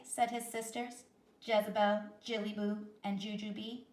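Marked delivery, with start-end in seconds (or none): none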